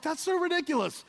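high-pitched indignant disbelief; incredulous protest. Acted. Crowd hearing Jesus's story, acted incredulous outburst. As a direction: scoff at it in a high voice.

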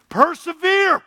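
high, loud cry of the word Persevere; shouted exhortation. Real, not acted. Preacher urging the church to endure, loud cry. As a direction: cry Persevere out over the room.

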